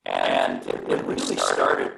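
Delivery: distorted low glitchy connection audio; garbled deep robotic line; gravelly voice. Real, not acted. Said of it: "I'm hearing sort of a gravelly voice".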